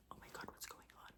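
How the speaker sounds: hushed, quick whisper; gossipy whisper. Retold or imitated. Club girls gossiping about the scene, whispered.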